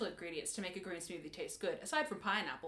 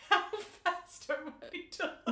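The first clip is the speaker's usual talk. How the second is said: high, laughing question; 9 semitones higher than usual. Real, not acted. Host to co-host about the pace, laughing. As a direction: giggle the question out.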